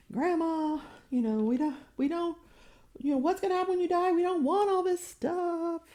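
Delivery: high, rushed pleading grandson; exasperated grandson. Pretend. Great-great-grandson begging grandma to let go, high and rushed. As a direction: talk grandma out of her stuff, exasperated and high.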